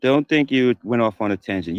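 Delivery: ordinary delivery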